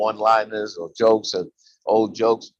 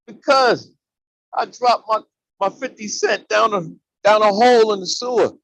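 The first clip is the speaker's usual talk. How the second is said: put-on higher, childlike complaint; little boy voice; 9 semitones higher, 5 dB louder than usual. Little boy telling the man about his lost coin, lightly childlike.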